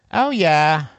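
put-on nasal teen voice. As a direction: say it through the nose. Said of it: "she said in her nasal voice".

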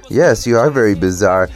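usual speaking voice

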